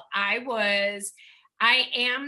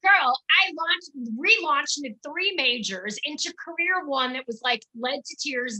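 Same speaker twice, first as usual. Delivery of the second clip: higher and louder, fired up; excited; 5 semitones higher, 6 dB louder than usual. Real, not acted. Guest excitedly telling the host her many relaunches.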